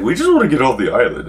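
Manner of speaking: mocking the party. Teller mimics companions who want off the island, mock whine. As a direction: mimic the others begging to leave. Rest of party wants to leave.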